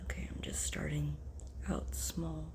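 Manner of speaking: whispering. Real, not acted. Quietly teasing nose.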